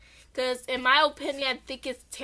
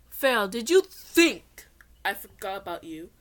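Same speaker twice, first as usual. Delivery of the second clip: louder, looming priest voice; menacing priest; 3 semitones lower, 7 dB louder than usual. Acted. Priest Seto threatens the pharaoh, loud and menacing. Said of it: "Priest Seto spoke".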